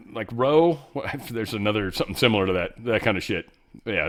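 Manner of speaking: ordinary talk, nothing marked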